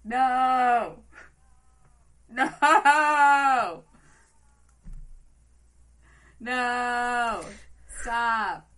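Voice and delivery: playful yelling no, pitched high; loud protest. Real, not acted. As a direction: cry out to make it stop. Protesting the podcast intro.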